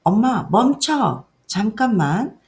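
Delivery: put-on high, loud child's command, drawn out; slow, emphatic retold child's shout; child calling halt. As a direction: voice a small child firmly stopping mom. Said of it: "아이가".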